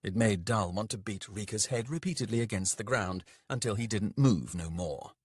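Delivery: usual speaking voice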